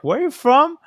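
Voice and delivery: high-pitched mock questioner; curious questioner. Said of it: "So I'll be like".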